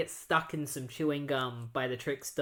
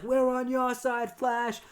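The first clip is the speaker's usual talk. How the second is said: higher, slower cheer of a crowd of citizens; rallying crowd voice; 9 semitones higher than usual. Retold or imitated. Citizens rallying behind the Flash, lightly voiced cheering crowd. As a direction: hype it up like a whole crowd backing the hero. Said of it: "They're just like".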